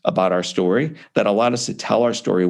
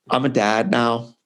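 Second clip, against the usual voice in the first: ordinary talk, nothing marked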